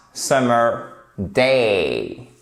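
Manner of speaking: very slow "day" with a long ay glide; drawn-out model of "day", vowel held; strong diphthong in day. Shown as a demonstration. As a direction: stretch "day" right out. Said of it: "le même son qui est fort".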